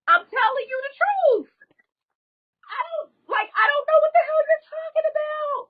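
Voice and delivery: high, breaking voice fighting back real tears; crying while telling. Real, not acted. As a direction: speak through real sobs, voice cracking up high. Retelling being hit, voice breaking.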